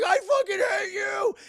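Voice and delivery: high, drawn-out screaming rage; freaking out. Retold or imitated. Retelling Cold Stone snapping at Goliath, loud wailing shout. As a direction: imitate a character losing it completely. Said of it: "he just starts freaking out".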